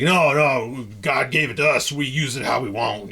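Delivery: a hint of a Southern preacher defending dominion. Lightly imitating a Southern dominion believer, Southern accent. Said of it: "I shouldn't do that in a Southern voice".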